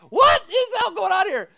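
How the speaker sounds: high, loud puzzled shout; father baffled by the laughter; loud bewildered question. Retold or imitated. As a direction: demand to know what's happening. Father confused amid laughter.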